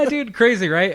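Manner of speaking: high, loud burst of real amazement; excited agreement. Real, not acted. Storyteller agreeing with stunned co-host about shooting, excited. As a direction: exclaim it, sharing the shock. Reacting to co-host's shock at story.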